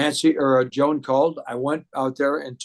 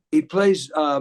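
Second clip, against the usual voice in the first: usual speaking voice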